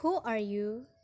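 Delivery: WH-question falling towards "you"; falling question intonation. Shown as a demonstration. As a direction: let the pitch drop at "you". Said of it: "W H question".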